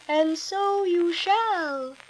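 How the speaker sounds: slow, soft magical voice; gently promise, very slow; fairy godmother. A narrator's reading. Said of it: "a voice behind her said".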